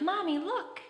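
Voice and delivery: put-on high excited little kid's call; eager child tugging at mom's attention; child calling mommy. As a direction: call out like a small child spotting a plane. Child calling to mom about airplane.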